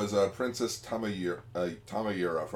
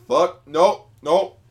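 higher, much louder repeated nope; panicked refusal; 5 semitones higher, 11 dB louder than usual. Acted. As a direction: bark out nope in a hurry. Zoffy panicking acted.

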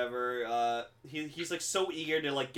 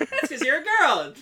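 very high, loud cartoon yell; mock shouted joke; 12 semitones higher, 8 dB louder than usual. Friend quotes a half-remembered cartoon joke, mock yell. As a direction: yell the punchline with a raised pitch.

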